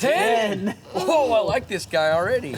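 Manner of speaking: loud, high, excited reaction; thrilled and amused; delighted surprise. Real, not acted. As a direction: whoop with delight at a bold guess. Delighted by child's big fish prediction.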